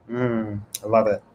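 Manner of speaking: ordinary talk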